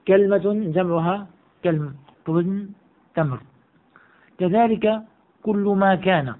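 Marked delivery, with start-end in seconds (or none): 0.1-2.0 كلمة as "KAL-ma", كلم as "kalm"; a after k, none after l in كلمة and كلم; kalma, plural kalm. Shown as a demonstration. Teacher pairs singular and plural, pronounced kalma and kalm. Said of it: "على وزن تمرة".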